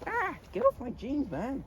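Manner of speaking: sudden high, drawn-out yelp of protest; startled protest. Real, not acted. Interrupted by a goat at his jeans, yelping protest. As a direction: break off and shoo the goat, voice jumping up.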